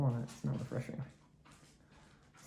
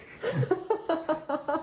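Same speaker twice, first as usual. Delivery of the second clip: shrieking laugh; 5 dB louder than usual. Real, not acted. Just won the auction.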